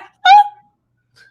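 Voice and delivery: high, loud startled huh; retold disbelief, high and loud; shocked huh. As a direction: squeak out a stunned huh. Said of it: "I was like".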